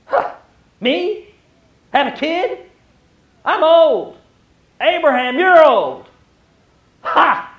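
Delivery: high and loud, scoffing disbelief. Acted. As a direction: laugh at an impossible promise. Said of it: "as disbelief".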